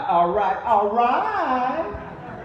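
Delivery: slow, high, stretched-out all right; drawled catchphrase. Acted. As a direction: draw it out like a laid-back movie star. McConaughey catchphrase after crowd call.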